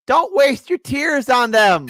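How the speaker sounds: high, loud, slow insistence; emphatic. Acted. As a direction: lean hard on every word. Emphatic advice.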